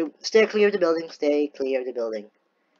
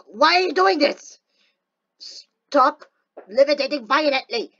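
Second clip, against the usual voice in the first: exasperated yelling; 11 semitones higher, 4 dB louder than usual. Real, not acted. Yelling at glitching character.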